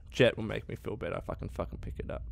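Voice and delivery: fast whispered aside; under his breath. Real, not acted. Gamer talking to himself about a drug, whispered.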